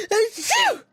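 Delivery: sharp gasp into a sneeze; inhale, then sneeze hard. Acted. Acted sneeze.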